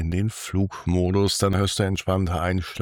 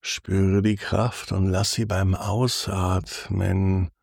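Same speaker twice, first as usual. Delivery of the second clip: sultry narrator. Acted. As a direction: lull the listener toward sleep, unhurried. Guided body scan for sleep.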